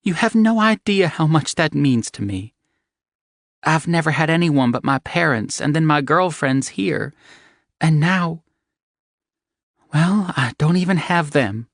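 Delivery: high woman's voice, emotional; moved young woman. Acted. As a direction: pour it out as the heroine. Evangeline, emotional and grateful.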